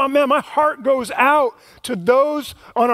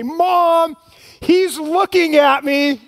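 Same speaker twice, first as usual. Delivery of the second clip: higher, slow drawn-out whine; whiny tattling little sibling; 6 semitones higher, 3 dB louder, slower than usual. Retold or imitated. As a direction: tattle on a brother, whining. Kids complaining in back seat.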